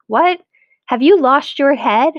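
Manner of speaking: high, loud disbelief; shocked wife. A narrator's reading. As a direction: cry out in alarm at him.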